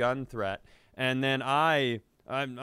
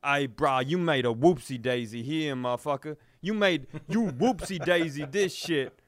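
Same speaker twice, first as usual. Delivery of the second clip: louder, higher hammed-up tough guy; mock dramatic thug; 4 semitones higher, 4 dB louder than usual. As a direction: ham up the threatening thug with baby words. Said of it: "He's like".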